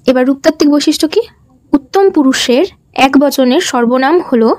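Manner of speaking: ordinary talk, nothing marked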